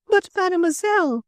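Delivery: very high, dismayed French madame; protesting Frenchwoman. A narrator's reading. Little Frenchwoman appealing to the editor, protesting.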